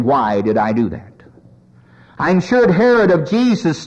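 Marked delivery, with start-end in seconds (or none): 0.0-1.0 low, subdued acted regret; remorseful cry. Belshazzar crying out from hell, low understated remorse. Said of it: "he's crying out and saying".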